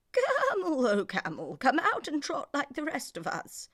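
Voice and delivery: high, loud coaxing horse voice; urging camel to trot, high horse voice; horse calling. Acted. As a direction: call him out like a bright eager horse.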